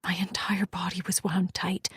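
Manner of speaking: ordinary delivery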